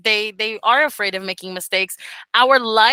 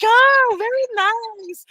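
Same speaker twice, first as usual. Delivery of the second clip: high, slow, drawn-out "very nice"; pleased students; 11 semitones higher, much slower than usual. Retold or imitated. Students happily accepting the birthday wishes, cooing. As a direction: coo it like a pleased beginner. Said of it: "they were like".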